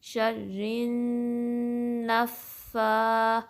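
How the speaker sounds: شَرِّن with n held vibrating in the nose; slow humming n into a pressed, long نَفَّا; nasal ghunna. Shown as a demonstration. Teacher giving time to ghunna, stretched شَرِّن نَفَّا. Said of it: "ناک میں vibration فیل ہوگی".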